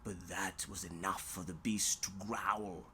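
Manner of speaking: deep, soft growling read; low and soft, beast growling. A narrator's reading. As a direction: drop low and menacing.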